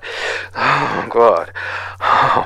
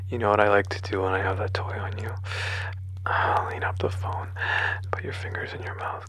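husky close whisper; 7 dB quieter than usual. Acted. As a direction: intimate whispered dirty talk. Whispered intimate dirty talk.

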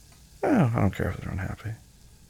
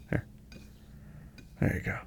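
whispering; 12 dB quieter than usual. Acted. Handing over the plate softly.

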